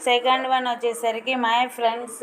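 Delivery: ordinary talk, nothing marked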